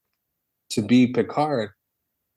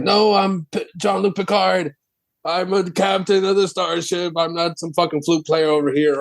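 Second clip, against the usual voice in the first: higher, offended captain protesting who he is; indignant Picard; 7 semitones higher than usual. Retold or imitated. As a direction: insist you're the captain, not some flute player, mock outrage. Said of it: "and he's like".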